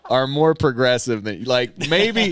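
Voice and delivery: laughing through a jab at Texas; high, loud, half-laughing mockery. Real, not acted. Comic ribbing Texas for lagging behind Oklahoma, laughing.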